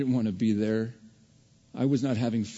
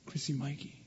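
the names called much softer, gently; 13 dB quieter than usual. Retold or imitated. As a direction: call them quietly and tenderly. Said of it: "and then we hear".

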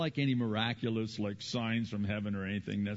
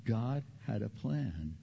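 usual speaking voice

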